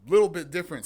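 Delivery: excited. Real, not acted. Excited realization, louder.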